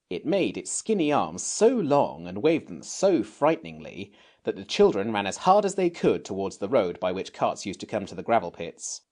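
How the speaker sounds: plain reading voice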